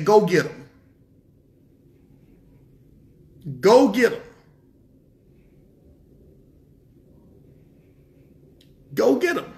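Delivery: God voiced sending nations against Israel; God commanding. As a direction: give the order like the Almighty. Voicing God sending enemies.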